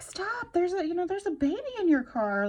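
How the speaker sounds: high, loud, frantic warning; reliving the panic, shouting at a stranger's car; panicked shout. Retold or imitated. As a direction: yell it like a kitten is in danger. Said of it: "I was freaking out".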